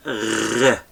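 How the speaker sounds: rough throaty "gh" for "R"; isolated letter-sound demo; soft Gamal sound. Shown as a demonstration. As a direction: sound "R" as a rolled gargle at the back of the throat. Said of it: "The sounds of this one is".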